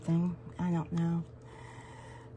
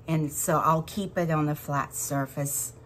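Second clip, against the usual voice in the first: ordinary delivery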